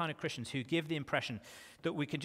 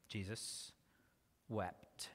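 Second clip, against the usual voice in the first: hushed solemn repeat; 5 semitones lower, 10 dB quieter, much slower than usual. Shown as a demonstration.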